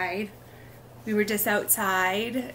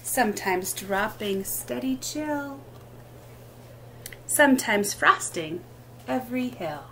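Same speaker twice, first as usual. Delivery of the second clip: sing-song picture-book reading, lilting rhythm; reading to children; slower than usual. Acted. Teacher reading rhyming book aloud at storytime, lilting. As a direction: read each line like a gentle rhyme for little ones.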